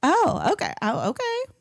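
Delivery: second okay jumps up high; bright fake acceptance hiding shock; taken aback. Narrator replaying her reaction to one and done. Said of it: "And I was like".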